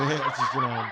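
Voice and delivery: breathy laugh into words. Real, not acted. To listeners after the ADHD joke, laughing. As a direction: let the laugh spill over.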